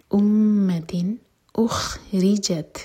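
امتن اخرجت drawn out slowly, the noon said clearly; slow recitation. Shown as a demonstration. Teacher showing the clear noon, slow recitation. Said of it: "clear پڑھا جائے گا".

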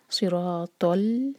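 सिरातल very slow, heavy तौ; recitation demo. Shown as a demonstration. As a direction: give सिरातल a thick ط. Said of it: "तौ को heavy करके पढ़ना है". (सिरातल भारी तौ से)

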